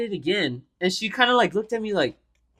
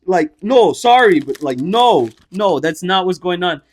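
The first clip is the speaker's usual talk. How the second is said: loud, repeated flustered denials; insistent, louder backpedalling; imitated freaking out; 7 dB louder, slower than usual. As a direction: act out a mock-panicked protest. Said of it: "starts freaking out".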